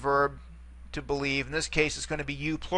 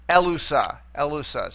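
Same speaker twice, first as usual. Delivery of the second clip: "elusa" with the accent on its first syllable, e; stress on first syllable. Shown as a demonstration. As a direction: say "elusa" stressing the opening E, then falling away. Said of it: "accent there on the first syllable".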